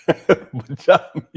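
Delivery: laughing out the word Pajamas, slow and drawn. Real, not acted. Streamer laughing at the clip, repeating its word. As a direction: crack up while repeating Pajamas.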